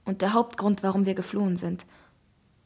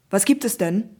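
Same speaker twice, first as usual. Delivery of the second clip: policeman's voice; 6 dB louder than usual. Acted. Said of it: "fragt der Polizist".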